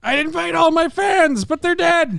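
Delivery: put-on high, cheery old showman voice; chirpy old star bragging; old entertainer voice.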